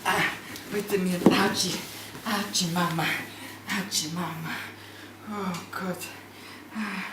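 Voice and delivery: a clown giving up on the pain; fading mock pain.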